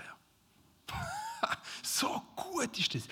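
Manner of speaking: soft, whispered wonder; whispered awe. Real, not acted. Pastor marvelling at the psalm, whispered. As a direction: hush it like a secret. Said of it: "eine Pause machen".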